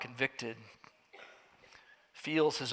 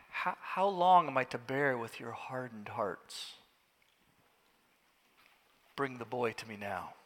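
sorrowful rebuke; 3 semitones lower, 7 dB quieter than usual. Acted. Jesus rebukes the faithless generation.

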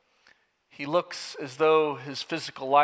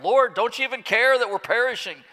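higher, louder panicked cry in a storm; 8 semitones higher, 6 dB louder than usual. Retold or imitated. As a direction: shout it over the storm in fear. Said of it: "crying out".

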